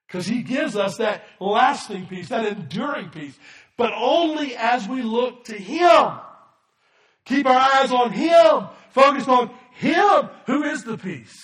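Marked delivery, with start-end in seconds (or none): 3.8-11.3 high, loud, slowed exhortation; preaching shout. Real, not acted. Pastor urging the congregation to look to Jesus, shouting. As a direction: lift it and shout it to the room.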